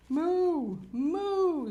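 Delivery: loud, high drawn-out moo; cow moo. A narrator's reading. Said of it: "said the cow".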